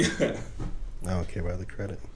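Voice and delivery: slow, soft repeat. Acted. Friend repeats it to the group, slow and soft.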